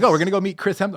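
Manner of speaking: high, loud mock thrill; hammed-up excited squeal; excited girlfriend. Girlfriend thrilled to meet Hemsworth, mock excited.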